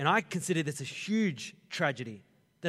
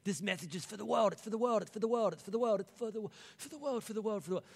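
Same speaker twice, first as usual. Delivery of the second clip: insistent repeated plea, higher; pressing the same words again and again; voicing Jesus; 6 semitones higher, faster than usual. Preacher voicing Jesus to the disciples, insistent.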